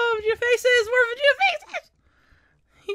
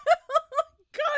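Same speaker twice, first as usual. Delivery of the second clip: ordinary delivery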